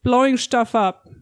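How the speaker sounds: "stuff" said as "shtuff", drawn out; German-style sh. Retold or imitated. Showing Rockwell's German sound rules, "stuff" as "shtuff". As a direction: slowly say "stuff" with sh for the s. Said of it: "German phonology rules. So he'll say".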